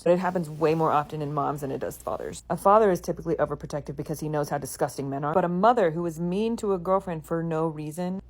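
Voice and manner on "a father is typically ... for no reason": ordinary talk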